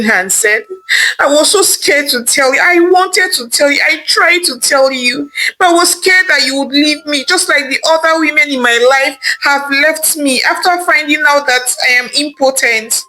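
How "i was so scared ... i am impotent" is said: husband begging his wife to stay. Acted. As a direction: plead with her not to leave.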